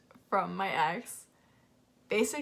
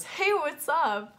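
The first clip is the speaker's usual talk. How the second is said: cheery phone greeting; 7 semitones higher, 5 dB louder, slower than usual. Retold or imitated. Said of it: "as she answers and goes".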